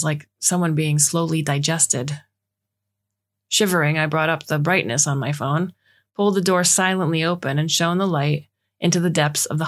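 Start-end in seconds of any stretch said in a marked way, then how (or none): none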